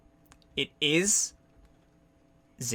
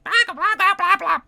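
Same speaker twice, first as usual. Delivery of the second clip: very high, loud little-critter squawking; freaking-out creature; 6 dB louder, faster than usual. Acted. Game master as the creature landed on the ground, frantic squawks. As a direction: squeal nonsense like a panicking small creature. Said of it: "the little one that's on the floor".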